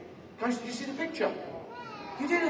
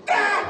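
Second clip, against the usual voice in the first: short loud high yelp; sudden high "ow" cry; pained yelp; 12 semitones higher, 7 dB louder than usual. Pretend. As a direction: yelp as if struck. Hit during wand trick, yelps.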